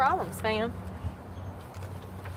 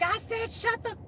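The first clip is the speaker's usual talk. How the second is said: put-on furious girlfriend; 10 semitones higher, slower than usual. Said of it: "she's like".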